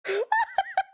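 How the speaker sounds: high burst of giggling; high laugh. Real, not acted. High laugh at her own insult in mock court. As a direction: let a squealing laugh out.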